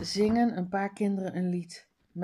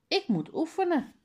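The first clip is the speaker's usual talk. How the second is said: Faisal calls back over his shoulder, much higher; calling out, voice higher; 9 semitones higher than usual. Acted. Faisal calls to Harry that he must practise, high called-out voice. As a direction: sing it out high and bright to a friend. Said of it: "roept Faisal".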